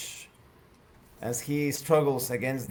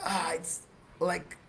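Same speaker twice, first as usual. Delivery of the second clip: higher-pitched character voice; Rao; 6 semitones higher than usual. Acted. Stuck character reports branch out of reach, higher voice. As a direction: raise the pitch, halting between phrases. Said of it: "Rouse claims".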